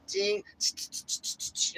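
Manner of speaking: whirring shh sounds with a high ding; imitated rotary dial. Imitating a rotary phone dialing, whirring and dinging. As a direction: mimic the dial spinning back.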